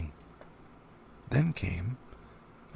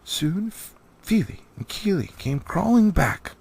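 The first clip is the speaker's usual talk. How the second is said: plain reading voice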